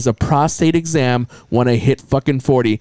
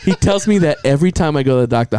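ordinary delivery

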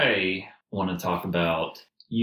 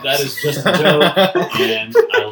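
high, loud words through real laughter; walk the joke back while still laughing; laughing disclaimer; 7 semitones higher, 8 dB louder than usual. Real, not acted. Host excusing a joke to listeners, laughing as they speak.

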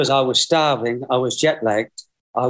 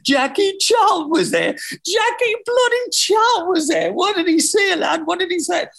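excited shout. Retold or imitated. Excited taxi driver retold.

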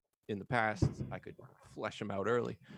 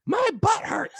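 put-on much higher, louder little kid yelling; child's yell; 9 dB louder than usual. Naked son yelling at the door in a high child voice. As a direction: shout it like a naked four-year-old.

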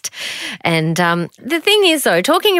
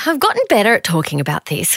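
ordinary talk

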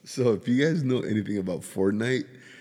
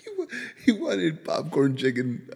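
laughing; 9 semitones higher than usual. Real, not acted. Laughing about son's wish list.

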